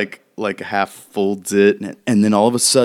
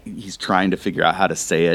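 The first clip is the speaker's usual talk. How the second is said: ordinary talk, nothing marked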